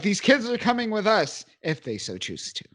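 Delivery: a touch of regal confidence; stern, confident. Retold or imitated. Storm claiming the kids, lightly stern and confident. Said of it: "stern and confident".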